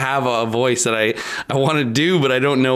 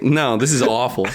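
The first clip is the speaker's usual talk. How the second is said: ordinary talk, nothing marked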